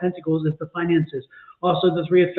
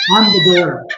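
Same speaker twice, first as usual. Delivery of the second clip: louder punchline, half-laughing; playful louder punchline for the inside joke; punchline delivery; 8 dB louder than usual. Shown as a demonstration. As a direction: land the joke with a grin. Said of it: "it's an inside joke".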